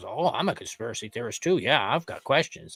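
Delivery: quick, dismissive mock voice; mocking impression. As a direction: sneer it, lightly mocking him. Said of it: "at the end then he goes".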